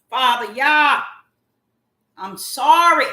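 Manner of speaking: loud, high cry to God; screaming apology. Real, not acted. Apologizing to Father Yah, screaming. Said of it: "I am screaming".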